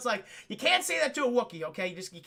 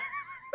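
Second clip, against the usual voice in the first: real laughter; 11 dB quieter than usual. Real, not acted. Helpless laughter.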